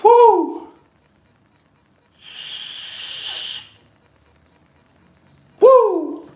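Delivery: loud high joyful whoop. Real, not acted. Celebrating after praising the Lord, loud high whoop. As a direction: let out a loud celebratory whoo.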